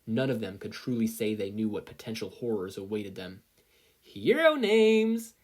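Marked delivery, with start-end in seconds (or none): 4.0-5.4 loud, drawn-out high announcement; yelled. Acted. Midnight kicking the door down, yelling slowly. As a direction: belt it out slowly as you burst in. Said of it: "yelled Midnight".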